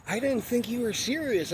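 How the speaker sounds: high, airy imitation of her caught off guard; mock surprised woman. Imitating her startled reply to the boyfriend. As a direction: play her as startled and fake-innocent. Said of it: "She's like".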